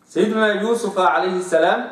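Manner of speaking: ordinary talk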